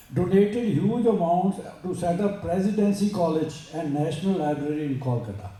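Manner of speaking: slow, measured English recitation; formal read-aloud. Acted. Speaker reading a great woman's story to listeners, slow formal read-aloud. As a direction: read it out word by word, slowly.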